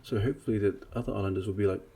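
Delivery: ordinary talk, nothing marked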